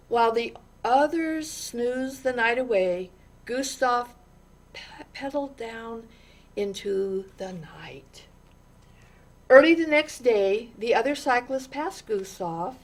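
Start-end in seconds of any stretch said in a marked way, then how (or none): 4.7-8.3 hushed. A narrator's reading. Quiet storytelling close.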